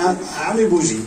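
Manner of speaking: halting Bengali with an American accent; foreign learner Bengali. Real, not acted. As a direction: feel for the Bangla words like a beginner. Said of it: "Bangla বোঝেন?".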